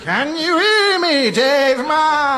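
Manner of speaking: high, slow ghostly wail; spooky ghost voice. Acted. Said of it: "doing his spooky ghouly voice".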